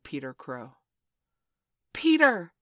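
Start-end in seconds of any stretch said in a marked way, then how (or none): 1.9-2.5 high, loud, excited shout; cry. A narrator's reading. Boys crying out as they hear Peter crow. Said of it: "they cried".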